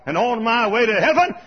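exultant revival shouting; high, loud declaration of praise; Billy Bray. Acted. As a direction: shout it out triumphantly. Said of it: "I'll just shout all the way".

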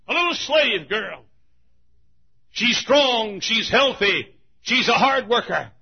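a hint of a hoarse auctioneer crying his wares. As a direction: call out the sale like an auctioneer. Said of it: "a voice that's hoarse from a day of use".

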